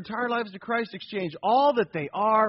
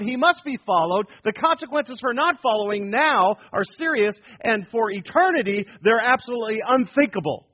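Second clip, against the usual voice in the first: louder, higher, insistent testimony; firm declaration; 4 semitones higher, 6 dB louder than usual. Retold or imitated. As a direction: state it like an unshakeable believer. Voicing a firm believer's declaration.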